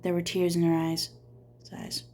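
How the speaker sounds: usual speaking voice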